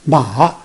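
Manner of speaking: "ma" falls, then rises; third tone. Shown as a demonstration. Teacher showing the word for horse, "ma" dipping then rising. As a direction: dip the pitch in "ma" and come back up. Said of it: "Third one is".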